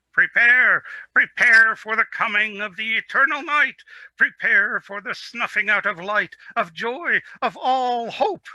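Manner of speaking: high, loud doom-laden goat wail. Acted. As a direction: wail the prophecy like a frantic goat. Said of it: "wailed the goat".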